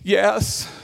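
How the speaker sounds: terrified. Retold or imitated. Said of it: "Mario, terrified".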